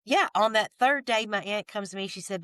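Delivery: normal talking voice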